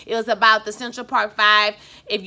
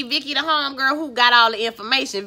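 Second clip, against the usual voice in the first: ordinary talk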